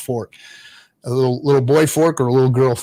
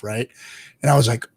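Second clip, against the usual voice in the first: ordinary talk, nothing marked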